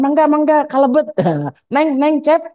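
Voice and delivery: high, singsong call of a Bandung bus conductor; Sundanese conductor call. Retold or imitated. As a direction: coax passengers in sweetly with a high lilt.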